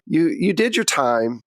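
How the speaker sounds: usual speaking voice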